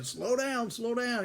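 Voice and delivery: high, anxious plea; scared passenger pleading with the drunk driver; pleading slow down. Retold or imitated. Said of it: "Jack kept saying".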